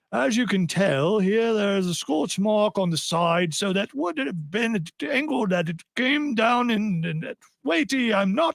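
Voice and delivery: slow, halting bad Irish accent; clumsy put-on Irish voice; attempted Irish accent. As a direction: try an Irish accent and miss. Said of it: "try to do an Irish accent".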